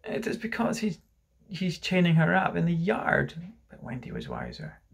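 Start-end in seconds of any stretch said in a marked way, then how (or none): 0.0-3.3 put-on high, halting child's whimper. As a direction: whimper it in a small raised voice. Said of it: "John whimpered".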